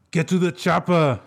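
high, loud action-hero order; Arnold imitation. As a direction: bark it like Arnold in Predator. Quiz quote as Schwarzenegger.

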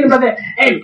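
normal talking voice

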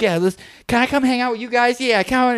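the little cousin begging the older kids, mock eager. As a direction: beg like a tagalong little cousin. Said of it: "they'd be like".

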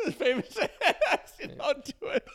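high, squeaky words through real laughing; helpless laughter. Real, not acted. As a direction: try to talk while cracking up. Breaks up laughing mid-story.

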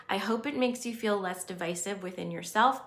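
usual speaking voice

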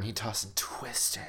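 hushed whispered quip. Acted. Co-host mocks the book's wording in a whisper. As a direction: breathe it out as a secret joke.

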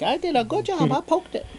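put-on squeaky high little-boy voice, soft; eleven-year-old boy bragging to the king, high and soft; proud little kid. The kid telling the king he poked the beast, high little-boy voice. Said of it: "And he was like".

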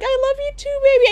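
high, sugary lovey-dovey coo; high mock girlfriend. Girlfriend cooing back at her boyfriend, high mock sweetness. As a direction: gush back like a smitten girlfriend. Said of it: "And you're like".

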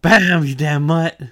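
high, loud, slow furious yell; Luffy turning on the dog, loud drawn-out hammed-up yell; mock angry smack. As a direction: hammer out each word like a punch. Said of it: "And then".

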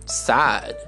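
usual speaking voice

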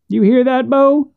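high-pitched question from a spooked man; spooked brother. Acted. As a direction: ask it high, as a jumpy backwoodsman. Voices a nervous brother in the story.

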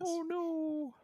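high thin whimper of alarm; small clay man crying oh no; Mister Bill voice. Retold or imitated. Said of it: "kinda like Mister Bill".

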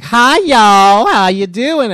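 loud, high, hollered Southern greeting; thick Southern accent. Shown as a demonstration. Host showing a far thicker accent to the panel, loud thick Southern accent. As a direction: exaggerate a big Southern accent at full volume. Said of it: "it's like having a Southern accent".

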